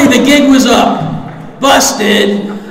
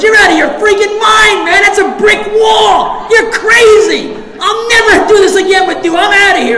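high, loud exasperated yell; a mock outraged shout, much higher; normal guy yelling; 11 semitones higher, 4 dB louder than usual. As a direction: yell him off, fed up. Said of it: "Normal guy gets up, goes".

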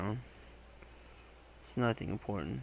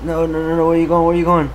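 louder, higher desperate no no no; frantic yelling; 6 semitones higher, 14 dB louder, faster than usual. Real, not acted. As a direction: shout after the runaway character. Character running off in game.

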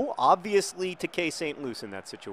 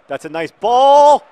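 loud, higher, drawn-out cheer; excited shout; 12 semitones higher, 19 dB louder, slower than usual. Real, not acted. Gamer cheering a nice pass in his match, excited shout. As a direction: stretch it out as a rising shout.

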